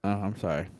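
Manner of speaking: ordinary delivery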